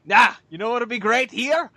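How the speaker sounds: put-on Scottish accent, like Shrek. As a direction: pitch an idea in a Scottish accent. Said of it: "he's, like, real Scottish".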